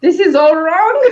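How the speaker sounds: high, laughing protest; laughing mock outrage. Reader switching back to Western comics, laughing mock dismay. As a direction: play up the confusion with a laugh. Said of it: "you're like".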